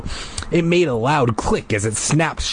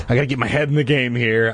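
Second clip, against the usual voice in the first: ordinary delivery, nothing marked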